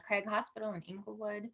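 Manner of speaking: ordinary talk, nothing marked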